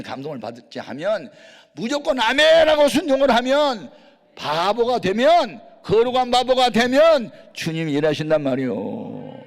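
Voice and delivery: loud, high, fervent pulpit exhortation; full-force preaching. Acted. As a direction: drive the amens home to the crowd.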